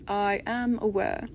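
plain reading voice

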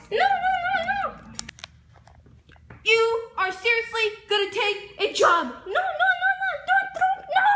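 very high wailing no's; high panicked wail. Acted. Mario keeps refusing.

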